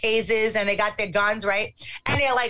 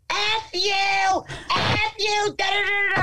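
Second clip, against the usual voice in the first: high-pitched, toned-down mock yell; retold screaming; 8 semitones higher, slower than usual. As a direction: re-enact screaming curses at security guards. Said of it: "let me scream at them".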